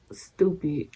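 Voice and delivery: unvoiced whisper of contempt; hushed disgusted aside. Real, not acted. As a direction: breathe the word out under your breath. Muttered aside on scam.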